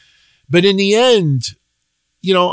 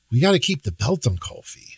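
softer "We gotta keep the belt on Kofi" with weight on "gotta"; quieter take; 4 semitones lower, 7 dB quieter, faster than usual. Shown as a demonstration. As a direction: say it low and resigned. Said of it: "different ways of saying".

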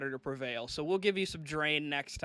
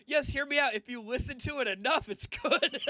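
mock defensive friend; 8 semitones higher than usual. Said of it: "And you go".

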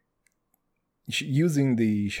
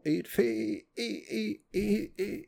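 slow, stuck syllables jumping much higher and fading softer; mock stammer; 8 semitones higher, 7 dB quieter, slower than usual. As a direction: stutter on "fe-" and let the voice crack up. Stammering, voice cracking high.

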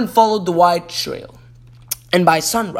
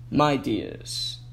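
groaned; 6 semitones lower, 9 dB quieter than usual. A narrator's reading. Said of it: "he groaned".

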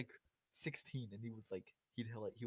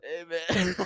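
higher, hammed-up stoner greeting; 5 semitones higher than usual. Co-host repeating his one impression, stoner voice. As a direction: put on a bad stoner impression. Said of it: "trying to do [name] and [name]".

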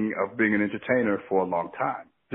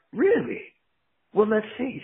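skeptical; 11 semitones higher than usual. Retold or imitated. Ted doubts the claim.

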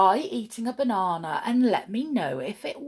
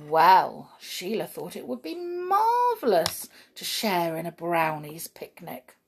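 ordinary delivery, nothing marked

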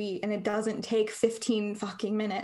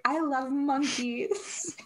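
mock author voice; 4 semitones higher, much slower than usual. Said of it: "Stephenie Meyer was like".

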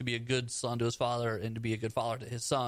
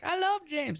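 squeaky high falsetto; high girly voice; slower than usual. Retold or imitated. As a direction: pitch way up for the girl. Voicing Keira Knightley's character.

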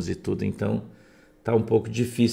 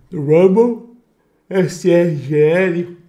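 excited shout; 9 semitones higher, 9 dB louder, slower than usual. Real, not acted. Calling out to livestream viewers.

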